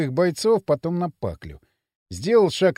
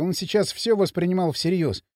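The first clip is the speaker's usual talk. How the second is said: ordinary delivery, nothing marked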